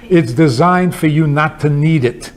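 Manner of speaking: usual speaking voice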